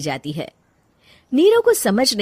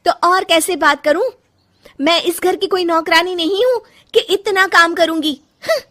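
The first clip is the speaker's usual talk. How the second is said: much higher, louder defiant shout; angry outburst; 9 semitones higher, 5 dB louder than usual. Acted. Rajni shouting at her mother-in-law, defiant and furious. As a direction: refuse to be treated like a maid.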